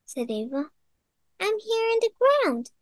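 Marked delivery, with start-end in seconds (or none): 1.4-2.7 worm voice. Acted. The worm answers.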